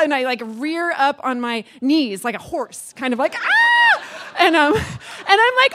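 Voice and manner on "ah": very high, loud re-enacted scream; contraction shriek. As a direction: shriek as if rearing up in labour. Rears up in contraction.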